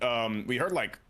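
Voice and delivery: usual speaking voice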